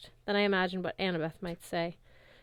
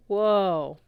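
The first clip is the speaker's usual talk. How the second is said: tourists' whoa; 7 dB louder than usual. Retold or imitated. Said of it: "A whole bunch of tourists went".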